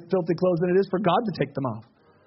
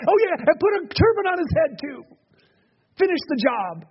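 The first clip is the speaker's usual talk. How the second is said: much higher, gleeful call; excited joining in; 9 semitones higher, 3 dB louder than usual. Acted. Zechariah jumping into the party, excited. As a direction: jump in eagerly, pitched right up. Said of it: "He's having a good time watching".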